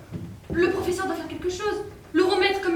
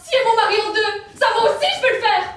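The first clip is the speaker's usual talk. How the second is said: loud, higher indignant yelling; angry yelling; 4 semitones higher, 4 dB louder than usual. Acted. Wife yelling at the magician's assistant, indignant. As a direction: shout your outrage at her.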